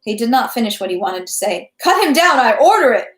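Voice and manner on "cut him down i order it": high, loud command; shouted order. A narrator's reading. Count ordering an execution, shouting out. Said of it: "Rostopchin shouted out".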